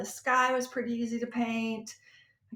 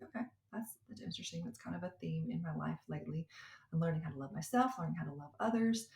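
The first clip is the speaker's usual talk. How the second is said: hushed realization; 4 semitones lower, 9 dB quieter, faster than usual. Real, not acted. Quiet inner realization.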